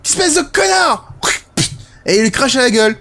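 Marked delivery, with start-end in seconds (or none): 0.0-1.1 high put-on child voice, slow spiteful insult; mock child taunting the villain; boy voiced. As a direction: sneer it like a vengeful kid. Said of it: "le petit Ethan qui fait".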